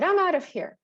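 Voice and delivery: high, loud frustrated snap, imitating the student; frustrated outburst. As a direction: throw it out like a kid storming off. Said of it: "and he said".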